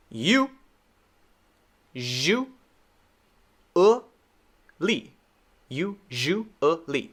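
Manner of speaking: "you, zhu, e, li" with long gaps; syllable by syllable. Shown as a demonstration. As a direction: break usually into separate slow syllables. Teacher demonstrates word syllable by syllable.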